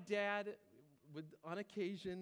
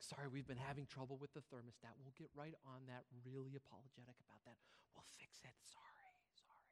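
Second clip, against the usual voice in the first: soft apology trailing away into a whisper; apologetic, fading to whisper; 6 semitones lower, 11 dB quieter than usual. Acted. Restaurant server apologizes to a chilly diner, fading apologetic whisper. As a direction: apologize low and quiet, shrinking to a whisper.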